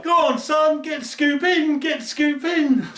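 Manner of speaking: high, excited urging; cheery high-pitched encouragement; egging him on. Retold or imitated. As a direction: hype him up to scoop the fish. Urging mate to net fish.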